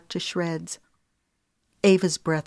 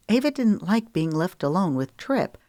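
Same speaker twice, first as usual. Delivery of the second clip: ordinary delivery, nothing marked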